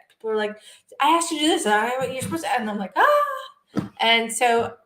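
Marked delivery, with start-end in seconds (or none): 1.0-2.5 slightly higher voice for nagging people; lightly mocked complaint. People chiding her when she is sleep-deprived, mock complaint. 3.0-3.9 high drawn-out "ah"; mock overwhelmed wail. Sleep-deprived woman reacting to people's demands, mock wail.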